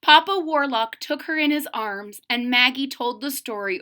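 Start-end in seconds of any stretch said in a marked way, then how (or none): none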